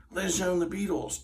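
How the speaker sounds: high-pitched show introduction; lightly voiced TV announcer. Host introduces the Beatles on TV, announcer voice.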